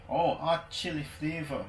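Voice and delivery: usual speaking voice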